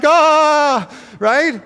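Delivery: high, loud alarmed cry; hammed-up fret over the humans; mock panicking God. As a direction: throw up your hands in fake alarm. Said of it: "He'd be like".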